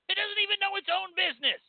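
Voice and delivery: high, fast mocking voice; squeaky mocking repeat. Real, not acted. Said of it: "repeated in high voice".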